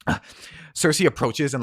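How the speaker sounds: plain reading voice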